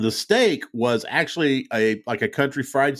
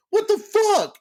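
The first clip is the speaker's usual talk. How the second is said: retold startled curse. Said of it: "I was like".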